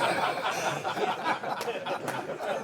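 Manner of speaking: high airy wheeze of laughter; breathy laugh. Real, not acted. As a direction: silent shaking laugh. Laugh at mother's reaction.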